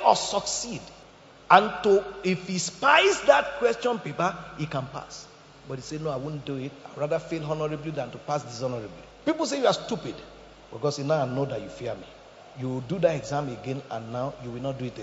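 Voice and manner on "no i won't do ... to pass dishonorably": softly spoken, firm refusal; quiet resolve. Acted. Student to himself refusing to cheat, quiet and firm. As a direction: say it low and soft but resolved.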